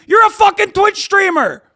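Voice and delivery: sudden high-pitched yell; exasperated outburst at a hypocrite; angry shout. Real, not acted. Streamer to a fellow Twitch streamer, angry shouting.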